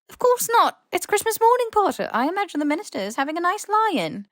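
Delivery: high, loud, brisk dismissal; old professor brushing it off; brisk headmistress. A narrator's reading.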